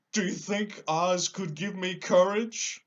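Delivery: high, loud, slow lion voice; a big cowardly lion protesting. Acted.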